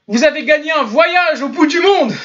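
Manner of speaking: high-pitched mock contest announcement; hammed-up scam pitch; mock prize announcer.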